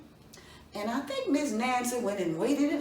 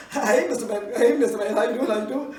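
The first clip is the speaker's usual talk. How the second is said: laughing greeting; 7 dB louder, faster than usual. Acted.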